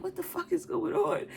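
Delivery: high, confused rising question; a hint of a worried husband; alarmed partner. Partner coming downstairs, lightly voiced alarmed question.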